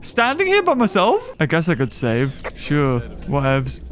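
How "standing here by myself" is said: high, quick mock bewilderment; mock dismay. Real, not acted. Gamer left alone in the facility, high mock dismay. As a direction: feign being abandoned.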